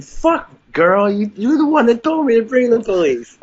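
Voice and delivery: high-pitched incredulous protest; retold exasperation. Teller silently answering the wife's question, exasperated.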